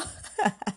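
quick amused laugh; short laugh. Real, not acted. Teller laughing after her own joke. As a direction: laugh at the punchline.

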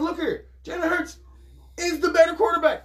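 high, insistent, know-it-all lecture; retold telling his friend Hurts is better, insistent; emphatic told-you-so. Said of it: "And I told him, I said".

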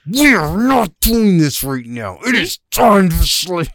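high, slow, angry words through gritted teeth. Retold or imitated. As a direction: hold back a shout through clenched teeth. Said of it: "Look at your angry gritted teeth speaking".

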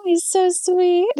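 high, slow, doting coo; gushing. Real, not acted. Host gushes fondly about her favourite character, high and slow.

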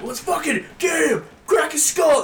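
high, hyped-up mock yelling; hammed-up aggressive rallying shout; mock war cry. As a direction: imitate a gang charging in. Acting out hyped bounty hunters.

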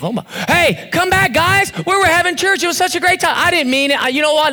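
high-pitched, mock-desperate pleading; hammed-up begging to departing friends; pleading people-pleaser. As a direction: call them back in a mock panic. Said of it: "And you think".

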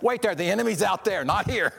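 mock battlefield alert; urgent warning. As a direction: point them at the real enemy. Urging fellow believers to refocus.

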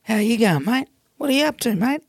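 put-on high, bright Australian accent calling out "g'day"; Australian bar greeting. Aussie in a bar greets the New Zealander, in a high Australian accent. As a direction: play the friendly Aussie greeting a visiting Kiwi in an Australian accent. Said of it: "in Aussie, they're just straight up".

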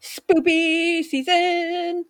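high, slow, sing-song exclamation; excited. Real, not acted. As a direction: stretch it out gleefully. Announcing spooky season.

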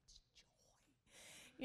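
breathless whisper; whispered answer. Retold or imitated. Husband whispering back the caller's name, hushed. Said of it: "and he's like".

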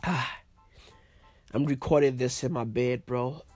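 stuffy, congested voice, lying in bed; blocked nose. Real, not acted. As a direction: talk through a blocked nose. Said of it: "I'm fucking blocked up".

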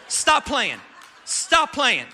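loud, hammed-up stop playing; husband yelling at his wife in mock outrage. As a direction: shout it in playful disbelief. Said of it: "I said".

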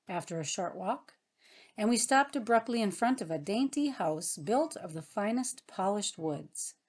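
usual speaking voice

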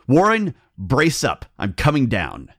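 loud, high, resolute call; shouted resolution. A narrator's reading. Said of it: "I formed and shouted a resolution".

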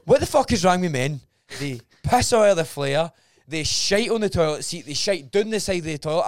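loud disgusted rant at men who make a mess; exasperated rant. Real, not acted. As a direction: vent it loud like you've cleaned it all. Ranting about men's toilets.

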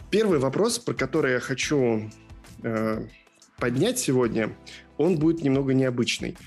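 usual speaking voice

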